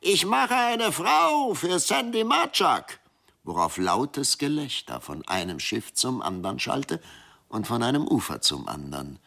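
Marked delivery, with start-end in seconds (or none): 0.0-3.0 very high, slow spirit voice, louder; even shriller voice. A narrator's reading. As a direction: drag it out high and eerie like a sea spirit. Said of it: "eine noch schrillere Stimme". (noch schrillere, sehr hohe Geisterstimme)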